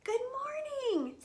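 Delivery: high little mouse voice; friendly mouse greeting. Acted. Country mouse says good morning to cows, high greeting. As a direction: greet them in a high lifted voice.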